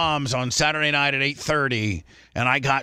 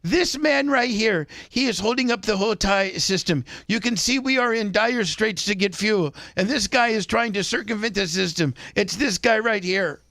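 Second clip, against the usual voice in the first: higher pitched mock Indian accent, ranting like a wrestling promo; 6 semitones higher than usual. Indian man denouncing the pump-cutter like a wrestling promo, in a higher mock Indian accent. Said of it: "cuts the biggest promo ever on this dude".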